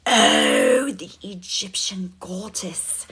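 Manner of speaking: whispered in starstruck excitement; awed whisper. Real, not acted. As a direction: hush it like seeing a goddess. Starstruck, recognizing viewer from event.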